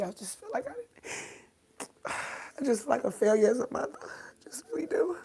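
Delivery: high, choked, tearful self-blame. Real, not acted. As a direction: voice climbing high into real crying. Grieving, near tears, blames herself.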